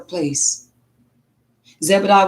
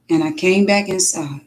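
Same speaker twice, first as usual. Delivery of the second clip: ordinary talk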